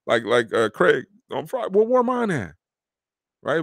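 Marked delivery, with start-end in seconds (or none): 1.7-2.6 higher whiny mock complaint; high mock where's mine; jealous whine. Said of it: "be like".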